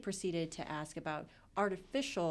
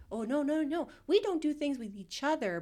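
higher, louder mock protest of the twins; 9 semitones higher, 5 dB louder than usual. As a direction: protest in a raised, put-on voice.

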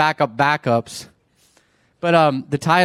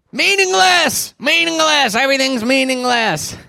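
put-on grumpy old man; 13 semitones higher, 6 dB louder than usual. Preacher acting out how the book starts off, grumpy shouting. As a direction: holler it like a cranky old man. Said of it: "he sounds like a grumpy old man".

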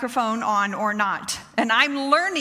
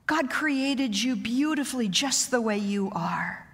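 tender mother; 3 semitones lower, 4 dB quieter than usual. Retold or imitated. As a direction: soothe your daughter gently. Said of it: "I remember telling her".

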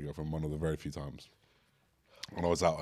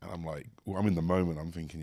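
usual speaking voice

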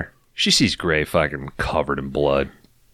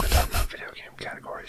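whispering; 8 dB quieter, much faster than usual. Pretend. Whispered aside while shushing.

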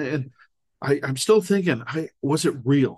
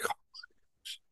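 hushed, much softer grumble; exasperated whisper; 15 dB quieter, faster than usual. Retold or imitated. Teen told to put burritos back, exasperated whisper. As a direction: breathe it under your breath in frustration.

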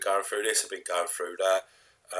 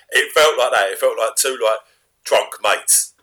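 ordinary delivery

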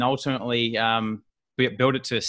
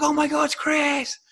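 much higher, slow squeal of recognition; starstruck wife. Retold or imitated. Wife recognising the actor in the street, high slow starstruck squeal. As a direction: gasp it like a fan. Wife spotting Chris Hemsworth.

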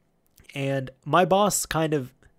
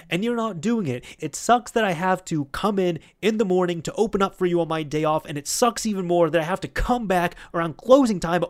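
raised, higher-pitched imitation of an angry boss; retold angry yelling; 5 semitones higher, 4 dB louder than usual. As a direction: tear into him, acting out the telling-off. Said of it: "he just starts yelling at Steve".

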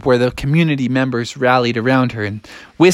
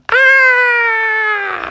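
high, long storyteller's scream; mock scream. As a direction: let out a high damsel-in-distress scream. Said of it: "they heard a scream".

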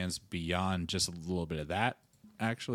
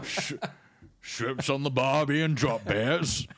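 mock Australian accent; 4 semitones higher, 3 dB louder, slower than usual. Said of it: "Cockney accent for the Australian".

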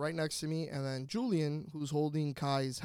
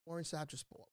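quiet aside; 12 dB quieter than usual. Retold or imitated. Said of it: "on the phone".

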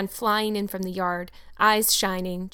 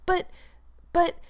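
faltering; 9 semitones higher than usual. Acted. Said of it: "faltered Anne".

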